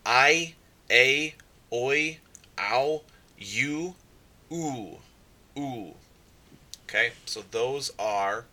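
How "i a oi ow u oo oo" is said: I a oi ow u oo rattled off, pitched higher then fading; diphthong drill. Shown as a demonstration. Teacher reviewing diphthong sounds for students in a drill. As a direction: list the vowel sounds like a drill. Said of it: "We have".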